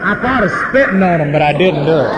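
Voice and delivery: laughing at his own anger; laughing confession. Real, not acted. Confessing a petty grudge with laughter.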